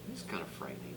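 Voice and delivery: ordinary delivery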